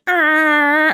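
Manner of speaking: high, loud, long horn blare; held train horn. Retold or imitated. Holding the horn as passengers load, long high honk. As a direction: imitate a horn and hold it. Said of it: "I would just hold the horn".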